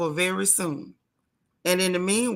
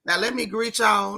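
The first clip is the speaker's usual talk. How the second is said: usual speaking voice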